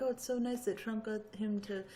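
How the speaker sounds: normal talking voice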